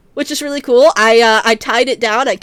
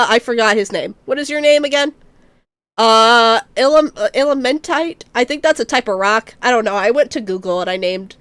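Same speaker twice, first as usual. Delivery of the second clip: usual speaking voice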